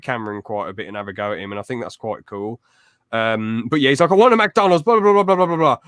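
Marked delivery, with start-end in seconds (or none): 4.1-5.8 high, loud imitated whining rant; mock demanding rant. Fan imitating YouTuber demanding McDonald's, loud mock rant.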